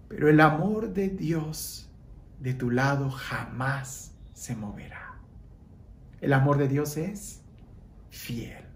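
softly fading, last word breathed; hushed and tender. Acted. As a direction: let the promise sink to a whisper. Softening sermon close.

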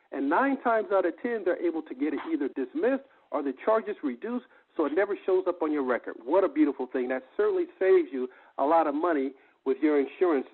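ordinary talk

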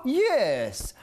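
normal talking voice

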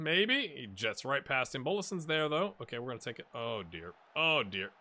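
ordinary delivery

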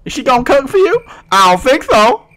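high put-on scolding girlfriend voice; sassy jealous woman. Girlfriend who saw him talking to pink creeper, jealous sassy scolding.

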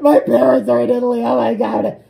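high, loud panicky wail; hammed-up freaking out over parents; mock panic. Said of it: "this girl starts freaking out".

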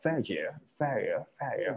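"failure" repeated as fay-yer, the L nearly dropped; slow repeated "failure" with a swallowed L; L-dropping demo. Shown as a demonstration. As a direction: model "failure" slowly, gliding past the L into "yer". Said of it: "มันจะละเสียง L ไป".

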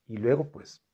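normal talking voice

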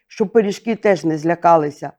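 ordinary delivery, nothing marked

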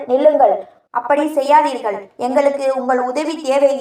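ordinary delivery

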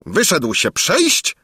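high, drawn-out royal disbelief; incredulous exclamation. A narrator's reading. King repeating the valet's news, incredulous. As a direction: echo it slowly as an astonished question. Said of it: "wykrzyknął król".

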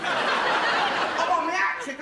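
slow, loud, laughing boast; gloating call. Retold or imitated. Gloating bowler calls it out.

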